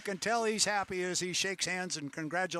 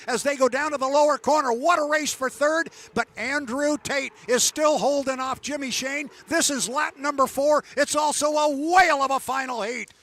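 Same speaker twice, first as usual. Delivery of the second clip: live play-by-play; 8 semitones higher, 10 dB louder than usual. Acted. Live race call.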